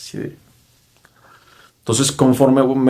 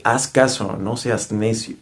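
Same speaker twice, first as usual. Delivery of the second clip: normal talking voice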